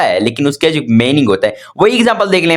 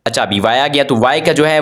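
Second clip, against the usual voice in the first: usual speaking voice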